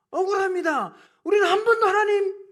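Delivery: high, very loud plea of innocence; aggrieved protest. Acted. Israelites pleading innocence before God, in aggrieved protest. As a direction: cry out as the wrongly accused. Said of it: "항변이 뭡니까?".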